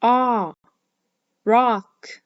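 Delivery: ah, then a firm rock; short O demo. Shown as a demonstration. Teacher modelling short O in rock. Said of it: "Did you hear the short O sound in rock".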